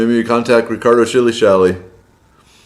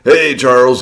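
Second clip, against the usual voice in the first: usual speaking voice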